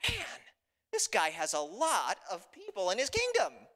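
high opening word, then slow, impressed delivery; relieved king. Acted. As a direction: marvel aloud in mild relief. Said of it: "Big sigh of relief".

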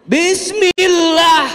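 boldly voiced loud prayer opening; loud drawn-out bismillah. Shown as a demonstration. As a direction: call it out bold and drawn out. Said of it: "kencengin suaranya".

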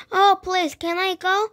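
high-pitched eager plea; pleading. A narrator's reading. Gertie asks to join ball.

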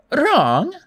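high, rising feigned puzzlement; Auntie Em. A narrator's reading. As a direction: ask it as an innocent old hostess. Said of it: "Auntie Em said".

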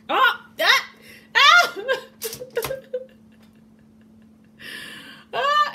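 sudden loud high shrieks with laughter; startled screams, then a laugh; shrieking. Real, not acted. Sauce squirts on wrist, screams.

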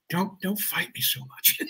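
slow, soft imitated plea; hushed aside. Actor privately asking a too-strong stand-in to ease off, hushed. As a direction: say it under your voice, slowly.

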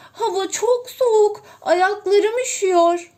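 high, loud tearful whine; crying. A narrator's reading. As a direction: whimper through the cold. Yavru ördek soğukta sızlanıyor. (ağlamaklı, yüksek sızlanma)

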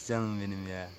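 low, soft doting sigh; tender. Real, not acted. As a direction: melt fondly over the animals, softly. Melting over the animals.

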